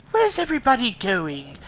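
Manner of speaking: put-on high comic accent, nearly tipping into the Joker; high mock action-hero line with comic accent; Leon joke voice. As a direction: pitch it up in a silly accented parody. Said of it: "almost my fucking Joker impression".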